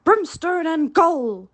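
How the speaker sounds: slow, loud echo of the captain's curse; mocking Hook's oath. A narrator's reading. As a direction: throw his own curse back at him. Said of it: "the voice retorted".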